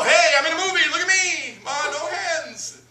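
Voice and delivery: put-on high show-off child voice, slowing at the end; kid showing off. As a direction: call out proudly like a kid. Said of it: "to let them know".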